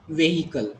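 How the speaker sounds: vehicle with a sounded H, VAY-hi-kul; deliberately wrong vehicle, "vay-hikal"; mispronunciation. Shown as a demonstration. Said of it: "You people correct me".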